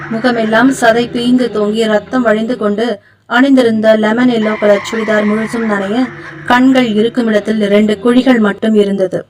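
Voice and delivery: ordinary delivery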